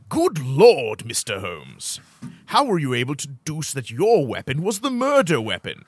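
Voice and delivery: hammed-up British detective exclamation; British accent. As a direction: put on a theatrical British accent. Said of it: "Most Britishy answer wins".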